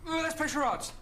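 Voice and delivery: a sudden burst of enthusiasm; eager suggestion. Acted. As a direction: jump at the idea. Priest eagerly proposes a game.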